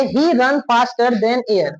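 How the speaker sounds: very slow, word by word; slow dictation. Shown as a demonstration. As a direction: read it to the class word by word. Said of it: "लिखा है".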